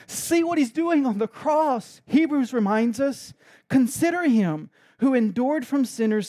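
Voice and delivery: loud, high impassioned preaching; preaching at full force. Real, not acted. Preacher urging the congregation to look, loud and impassioned. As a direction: drive it home to the congregation.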